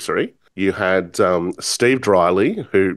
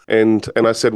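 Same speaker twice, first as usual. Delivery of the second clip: usual speaking voice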